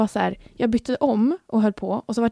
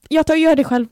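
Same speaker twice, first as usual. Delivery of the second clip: short, sharp, louder brush-off; curt snap; 6 semitones higher, 5 dB louder than usual. Retold or imitated. Retelling snapping at the helpful boyfriend, curt. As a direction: cut him off curtly. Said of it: "så här kort i tonen".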